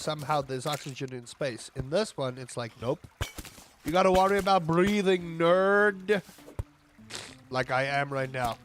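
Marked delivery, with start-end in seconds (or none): 3.9-6.2 louder, teasing jab at the player; playful taunt; mock taunt. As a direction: rub it in like a smug game.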